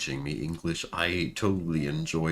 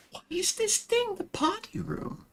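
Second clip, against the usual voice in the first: much higher, playfully baffled; high mock-puzzled voice. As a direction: squeak it like a confused little colonist.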